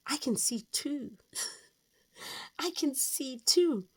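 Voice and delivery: high, delighted announcement with a laugh; excited reveal. Retold or imitated. Sonographer delighting the parents, high and laughing. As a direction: break the twin news with a giggle.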